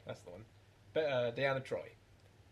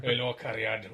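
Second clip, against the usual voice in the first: broad Welsh greeting, louder; Welsh accent; 2 semitones lower, 7 dB louder, slower than usual. Retold or imitated. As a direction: lay the Welsh on thick. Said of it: "Never sounded so Welsh".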